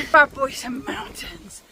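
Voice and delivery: usual speaking voice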